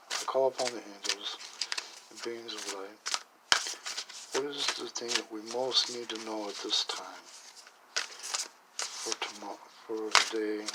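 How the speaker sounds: soft, reverent invocation; hushed prayer. Real, not acted. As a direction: quietly call on the angels. Prays to Spirit for card.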